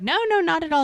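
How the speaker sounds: hasty mock reassurance. Telling the visitor she is not interrupting, hastily reassuring.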